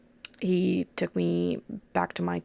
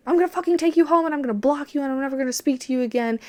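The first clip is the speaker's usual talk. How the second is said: louder, higher, fast furious threat; retold scream; 7 semitones higher, 4 dB louder, much faster than usual. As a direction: rattle off the threat like an enraged boyfriend. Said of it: "screamed at me".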